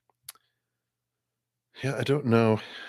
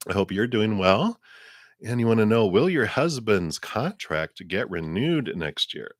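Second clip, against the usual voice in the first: ordinary talk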